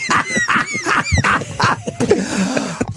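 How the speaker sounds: very high laughing fit; real, long cackling laugh; helpless laughter. Real, not acted. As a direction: collapse into high-pitched laughing. Cracks up at punchline.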